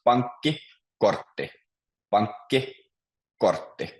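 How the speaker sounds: pankki, kortti split in two, each stressed on its first syllable; teacher's careful model of pankki, kortti with stressed word beginnings. Shown as a demonstration. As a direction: stress PANK in pankki, KORT in kortti, pausing between. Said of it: "always stress the beginning of the word".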